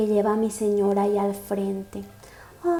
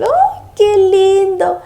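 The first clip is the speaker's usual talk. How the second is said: swooning squeal; 8 semitones higher, 7 dB louder, much slower than usual. Real, not acted. Swooning over the couple.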